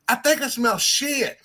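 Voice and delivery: put-on country-accented little girl. Dad imitating his swearing four-year-old, country accent. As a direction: imitate a small girl with thick country twang. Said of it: "amazed by her accent".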